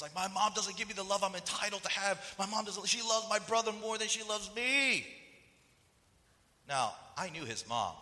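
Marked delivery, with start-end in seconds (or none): 0.1-5.1 high, aggrieved mock whine; worked up, pitched high, building to the end; angry complaint. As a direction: imitate a resentful son protesting unfairness. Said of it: "he was just so angry".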